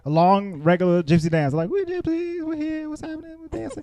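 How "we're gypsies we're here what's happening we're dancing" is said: high, soft mocking voices; sing-songy parody of dancers.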